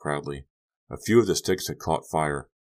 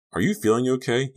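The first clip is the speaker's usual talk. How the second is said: higher, louder questioning voice; raised-pitch, mildly concerned asking; 9 semitones higher, 5 dB louder than usual. A narrator's reading. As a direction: check that they're all right. Worried question to dreamy companion.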